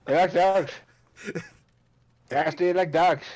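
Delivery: hammed-up thick Irish traveller accent; Snatch pikey accent. Riffing on Snatch for the table in a mock pikey accent. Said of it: "the pikeys from Snatch".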